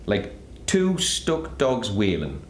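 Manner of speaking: slow, drawn-out witness quote; lightly acted witness, dragging the words; quoted witness. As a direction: stretch it out like an eyewitness's quote. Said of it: "one witness said".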